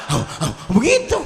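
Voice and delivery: mock breathless panting; imitating a gasp after holding breath; gasping for air. Shown as a demonstration. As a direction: pant as if out of breath. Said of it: "ngap-ngapan".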